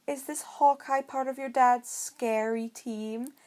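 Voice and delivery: a light mocking question; slightly mocking. A narrator's reading. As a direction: tease him just a little. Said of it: "voice only slightly mocking".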